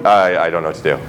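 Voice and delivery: low, soft stammering whimper; mock helpless mumble; helpless believer. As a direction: sound small and lost. God mimics helpless believer.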